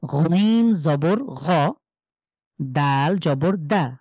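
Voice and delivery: গইন জবর গ spelled out very slowly; slow, careful teacher spelling. Shown as a demonstration. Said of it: "কোনোভাবেই টানা যাবে না".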